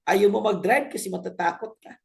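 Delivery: usual speaking voice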